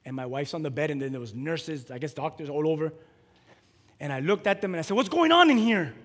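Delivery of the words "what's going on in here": loud, high demand; bold challenge. Retold or imitated. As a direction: burst in and demand answers. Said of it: "I went in with boldness".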